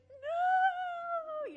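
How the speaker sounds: put-on high, loud toddler scream; toddler's scream. Mom mimicking her son's high loud scream. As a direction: shriek it like a distraught two-year-old. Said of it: "then he was like".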